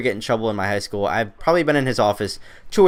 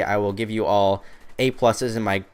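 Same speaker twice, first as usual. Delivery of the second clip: normal talking voice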